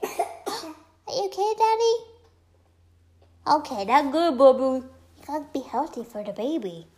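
high, sing-song talk to a little one. Real, not acted. Mother checking on her coughing baby, real baby talk.

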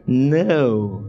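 "No." in a light put-on accent; lightly accented no; different accent. Shown as a demonstration. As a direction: try the "No." in another accent. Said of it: "Say it in different accent".

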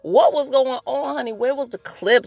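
put-on high, loud incredulous girl-talk; mock-scandalized interrogation; sassy questioning. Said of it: "my question is like".

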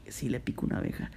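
a private realization, whispered; whispered aside. Retold or imitated. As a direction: breathe it under your breath. Retold thought to himself.

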